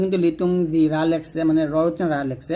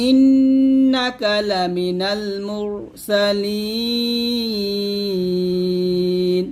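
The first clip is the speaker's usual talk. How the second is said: slow chant, ইন্নাকা with long nasal hum; Quran recitation; much slower than usual. Shown as a demonstration. Teacher reciting so students can follow. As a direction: draw the verse out melodically.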